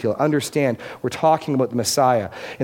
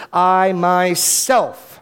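I myself drawn out very slowly and louder; emphatic I myself; 4 semitones higher, 4 dB louder, much slower than usual. Shown as a demonstration. Preacher pressing God's personal care: emphatic I myself. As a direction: dwell on I myself. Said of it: "notice that's emphatic".

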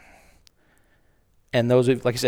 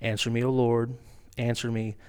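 much softer, hushed pleading prayer; earnest prayer; 3 semitones lower, 6 dB quieter than usual. Acted. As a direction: pray softly to the Lord, humbly. Elijah prays at the altar.

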